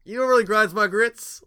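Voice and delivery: high, loud rant catchphrase; mock-furious catchphrase; catchphrase delivery. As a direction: belt it like a TV rant. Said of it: "I've never heard Grind My Grits".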